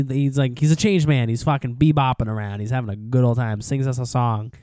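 usual speaking voice

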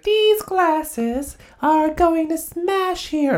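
put-on very high, very slow fussy old lady voice; old lady warning. Host mimics the woman warning about glasses, high and slow. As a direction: draw it out like a fretful busybody. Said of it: "she comes by and she's like".

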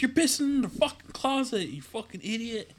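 put-on high, scolding girlfriend voice; angry girlfriend. Girlfriend scolding him for peeing in the closet, high and shrill. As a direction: shriek at him like the fed-up girlfriend. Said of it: "She's like".

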